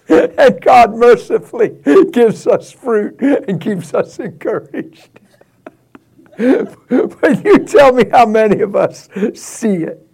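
real laughter breaking up the words; high, halting words shaken by laughs; laughing while preaching. Real, not acted. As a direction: keep preaching through helpless laughter.

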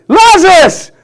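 high, booming call to raise the dead; commanding shout. Acted. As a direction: call it out with full authority. Acts Jesus calling the dead man out.

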